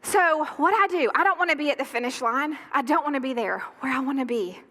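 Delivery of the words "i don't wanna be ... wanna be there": normal talking voice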